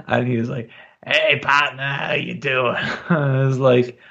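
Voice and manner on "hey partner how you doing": lightly imitated Boston Irish accent; a hint of a Boston Irish barfly; Kevin Sullivan. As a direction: attempt a Boston Irish greeting. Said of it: "thick, gruff Boston Irish accent".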